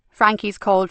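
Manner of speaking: plain reading voice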